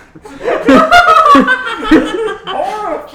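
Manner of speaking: loud high evil laugh; evil cackle. Acted. Panty cackling over trapped Chuck, loud evil laugh. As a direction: cackle like a cartoon villain. Villain laugh after threat.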